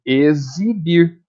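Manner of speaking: "Exibir" slowly, syllable by syllable, with buzzing z; slow demonstration of z in "Exibir". Shown as a demonstration. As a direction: stretch out "Exibir" as e-zi-bir. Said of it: "o X tá com som de Z".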